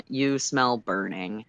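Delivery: ordinary delivery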